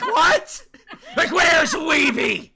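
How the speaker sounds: high, loud, drawn-out acted shout; mock outraged yelling. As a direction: exaggerate the shouted demand. Acts out himself and demanding chat.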